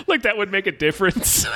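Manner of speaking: talking through real laughter, high; laughing. Real, not acted. Scoffing to a co-host at the characters' silly idea, laughing. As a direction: laugh your way through the line.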